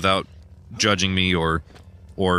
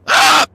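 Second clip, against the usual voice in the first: sudden high shriek of fright; a real jump-scare shriek; startled scream; 10 dB louder than usual. Real, not acted. Gamer jolted by a jump scare, startled scream. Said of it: "I don't know why I scream so much now".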